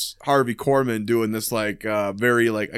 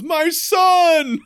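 high, loud mock-proud shout; shouted My son; 4 dB louder than usual. Father meeting the boy, high, loud proud shout. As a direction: cry out like a proud robot father. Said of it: "he goes".

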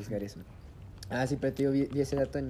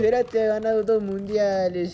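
fanfarronada en broma; 7 semitones higher, 8 dB louder, slower than usual. Real, not acted. Mock boast in football banter.

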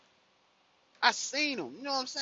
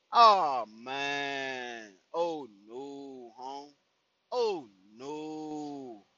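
dismayed groan; 6 semitones lower, much slower than usual. Retold or imitated.

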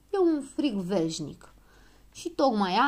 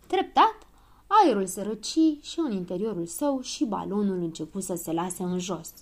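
plain reading voice

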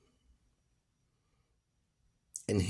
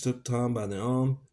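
usual speaking voice

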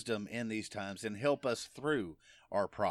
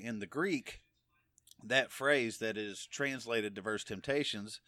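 ordinary delivery

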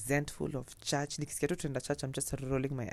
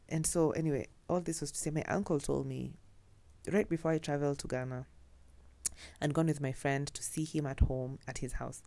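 normal talking voice

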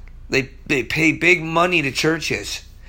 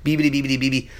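playful mock stammer, kept low; joking babbled stammer; Porky Pig stutter; 6 semitones lower, 3 dB quieter, much faster than usual. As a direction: lightly imitate a cartoon stutter. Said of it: "I'm not Porky the Pig here".

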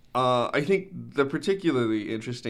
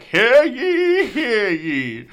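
put-on booming old-timey town crier; 6 semitones higher, 6 dB louder, much slower than usual. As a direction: proclaim it like a medieval herald. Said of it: "he turns around and he is like".

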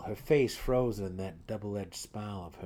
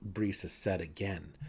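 ordinary delivery

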